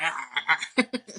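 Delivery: laughing imitation of agony; imitated pained scream. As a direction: wail comically as if bitten. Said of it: "starts biting the guy's hand".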